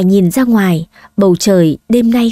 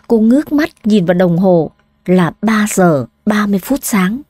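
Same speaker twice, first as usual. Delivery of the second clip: ordinary delivery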